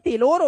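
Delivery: टेलर as "tay-lore", high-pitched; mispronounced tailor. Acted. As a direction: act out the mistake with a long O in टेलर. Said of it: "टेलर नहीं हूं जी". (टेलर को "टेलोर", ऊँची आवाज़ में)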